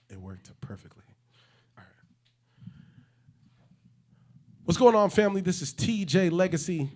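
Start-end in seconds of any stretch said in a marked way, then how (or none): 4.6-6.9 big, high radio-host greeting; hype intro. Acted. Host opening the podcast, loud and high. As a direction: greet the family like a DJ. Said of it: "hype intro take".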